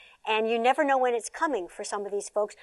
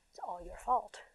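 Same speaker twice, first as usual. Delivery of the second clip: whispered, softer accusation; whispered blame; 11 dB quieter than usual. Retold or imitated. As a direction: hiss the blame under your breath. Abusive parent blaming child.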